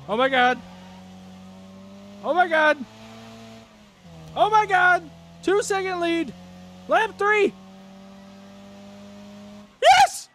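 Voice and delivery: rising to an ecstatic shriek; building victory screams. Real, not acted. Winning the race.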